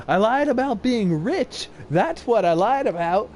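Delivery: put-on high, loud taunting kid voice; cheeky kid. Boy taunting the lady, high cheeky voice. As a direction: taunt her up high like a bratty child. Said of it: "looked to the lady and said".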